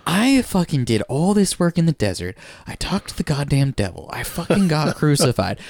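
hammed-up exasperated tirade; super pissed. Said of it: "he starts getting super pissed".

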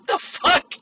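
a high, breathless, disbelieving "the fuck"; incredulous. Real, not acted. Reacting to the customer mistaking loss prevention for ice, breathless disbelief. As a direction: gasp it out in disbelief.

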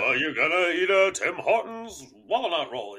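goofy cartoon bear voice, swooping pitch; Yogi Bear. Co-host echoing the teasing friend's voice, goofy cartoon bear. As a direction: put on a playful cartoon bear impression. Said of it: "like you were doing Yogi Bear".

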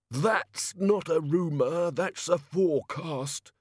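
slow, nauseous quip; queasy fat god. A narrator's reading. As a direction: groan it like a god about to be ill. Sick fat god answers.

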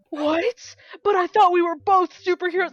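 high, loud mock disbelief; hammed-up betrayed hero; Barry. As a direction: act out a wounded, shocked protest. Acts out Barry's shock at Oliver.

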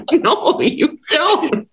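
high, drawn-out imitation of a protesting mother; laughing mock objection; mom's exasperated retort. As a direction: stretch it out high through laughter. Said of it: "She was like".

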